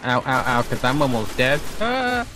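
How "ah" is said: short cry, shooting up high; high-pitched "ah"; high yelp. Real, not acted. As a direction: yelp as you nearly die in game. Almost dead in the game.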